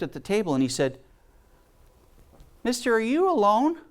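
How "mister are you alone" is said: put-on higher, innocent child asking; mildly higher little boy voice. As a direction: ask it like a curious eight-year-old.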